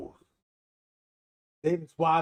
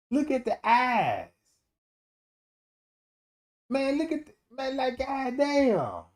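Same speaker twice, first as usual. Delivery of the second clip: excited disbelief; 10 semitones higher than usual. Real, not acted. Marvelling at dog picture.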